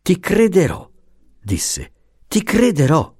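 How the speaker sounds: high-pitched, indulgent assurance; compiacente. A narrator's reading. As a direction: give in obligingly, voice lifted well up. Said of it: "d'un uomo compiacente". (tono compiacente e più acuto)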